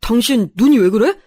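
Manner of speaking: taken aback and worried; startled concern. A narrator's reading. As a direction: ask in sudden alarm. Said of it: "깜짝 놀라더라고요".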